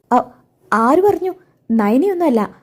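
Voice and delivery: high, sudden "who said that?"; startled denial. Acted. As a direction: jump up in pitch as if caught out. Adarsh startled by the question. (ഞെട്ടലോടെ ഉയർന്ന സ്വരത്തിൽ നിഷേധം)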